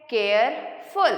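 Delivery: Careful in two slow, separate beats; broken in two. Shown as a demonstration. Teacher demonstrating two syllables to students, careful broken in two. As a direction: split careful after care, pause, then फुल. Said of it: "दो बार में बोला".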